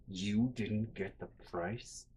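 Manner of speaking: low, slow, softly drawn-out question; ominous disbelief. Retold or imitated. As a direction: imitate mom quietly simmering before exploding. Mom's ominous quiet before shouting.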